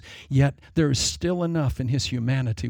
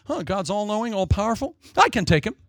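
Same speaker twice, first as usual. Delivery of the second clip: higher, boastful mock-devil voice; cocky Lucifer; 7 semitones higher than usual. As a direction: brag like a cocky fool taking on God. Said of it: "a comedian saying about Lucifer".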